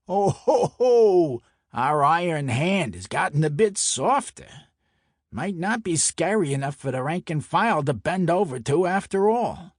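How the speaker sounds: loud, high jeering ho-ho, then lilting mockery; high-pitched, hammed-up ridicule; mocking taunt. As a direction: taunt him as a gloating Brooklyn mobster. Enforcer mockingly taunts the narrator.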